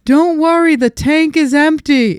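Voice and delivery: high, loud call to people far off; retold yell. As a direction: holler it slowly across a beach. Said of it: "She was yelling it to the people".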